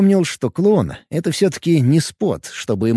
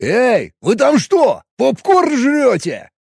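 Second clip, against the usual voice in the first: indignant; 10 semitones higher, much slower than usual. Acted. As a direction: yell in outrage, drawing it out. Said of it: "возмутился Боб".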